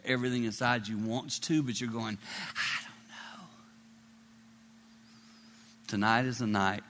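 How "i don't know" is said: soft, whispered doubt; hesitant doubt. Acted. Listener doubting that God loves them, hesitant whisper. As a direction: whisper it, unsure.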